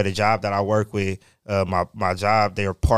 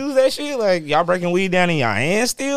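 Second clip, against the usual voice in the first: incredulous mocking; 9 semitones higher, 3 dB louder than usual. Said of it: "He's like".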